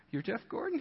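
soft, high questioning rise; mock stunned disbelief. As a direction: imitate an awed, hushed double take. Salesman astonished to recognize Jeff.

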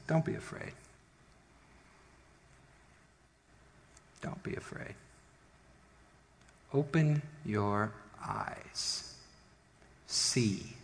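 very soft, nearly whispered calm; hushed reassurance. Acted. Prophet calms the terrified servant.

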